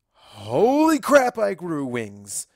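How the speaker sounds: high, loud, drawn-out gasp of disbelief; shocked outburst. Acted. Twilight waking up to find she has wings, a loud slow shocked cry. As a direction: shout it slowly, stunned by the wings.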